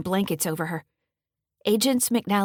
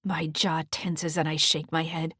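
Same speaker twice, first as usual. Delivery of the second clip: plain reading voice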